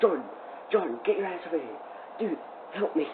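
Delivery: hurried, hushed call for help, a bit quick; hushed urgent call. Retold or imitated. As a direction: keep it down but get him over here fast. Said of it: "trying not to be, like, too loud".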